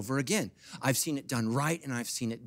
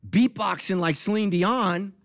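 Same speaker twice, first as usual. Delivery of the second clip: loud, slow, higher-pitched punchline; emphatic preaching; 6 semitones higher, 6 dB louder, slower than usual. Real, not acted. Preacher warning worshippers about distracting visitors, loud and emphatic. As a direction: hammer the absurd image out slowly. Hammers absurd image to church.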